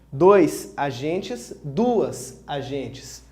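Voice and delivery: dois and duas spelled out very slowly; slow down to show the numeral changing; teacher's slow, deliberate example; slow demo of determiners. Shown as a demonstration. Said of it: "usando um numeral como determinante".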